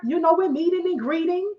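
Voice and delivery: usual speaking voice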